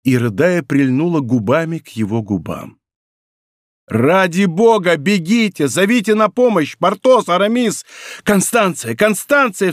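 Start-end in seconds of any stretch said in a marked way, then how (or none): 3.9-9.7 high, frantic call for help; anguished cry. A narrator's reading. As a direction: cry out as a lover dies. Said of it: "вскричал Д'Артаньян".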